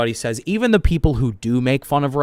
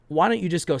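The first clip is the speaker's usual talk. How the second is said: ordinary talk, nothing marked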